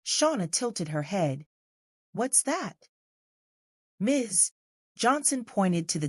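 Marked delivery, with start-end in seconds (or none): 2.1-2.9 put-on child voice. Child asking mom.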